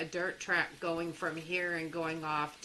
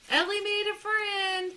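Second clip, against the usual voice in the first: high squeal; 11 semitones higher, 3 dB louder than usual. Real, not acted. Repeats it squealing higher.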